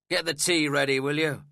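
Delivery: fierce order. A narrator's reading. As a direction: snap the order at her. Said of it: "he added fiercely".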